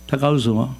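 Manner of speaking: ordinary talk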